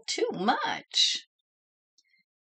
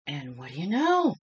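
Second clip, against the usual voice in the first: usual speaking voice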